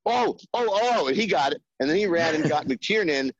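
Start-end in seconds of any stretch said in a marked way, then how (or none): 0.1-1.1 puzzled, jumping high into sudden understanding; dawning realization. Retold or imitated. Producer suddenly grasping the writer's idea, surprised realization. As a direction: act out the penny dropping.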